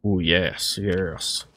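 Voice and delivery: ordinary talk, nothing marked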